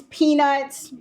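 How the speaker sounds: ordinary delivery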